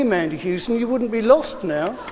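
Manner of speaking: ordinary delivery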